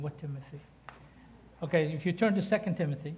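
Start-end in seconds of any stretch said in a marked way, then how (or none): 0.0-0.6 puzzled mumble. Retold or imitated. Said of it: "How many of you looking at me going".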